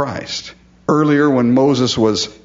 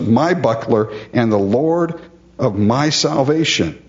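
ordinary talk, nothing marked

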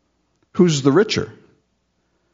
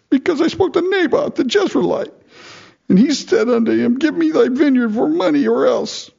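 whiny king; 13 semitones higher than usual. Retold or imitated. Pouting Ahab whining to wife.

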